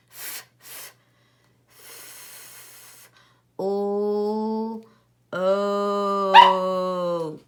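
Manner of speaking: sounding out F, f, f, o, o, first hushed then loud; stretched-out letter sounds. Shown as a demonstration. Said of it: "you can stretch it out".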